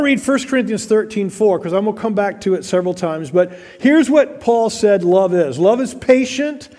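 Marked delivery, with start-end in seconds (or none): 5.6-6.7 slow, higher solemn reading of scripture. Acted. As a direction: lift the verse slowly and solemnly. Reads scripture aloud.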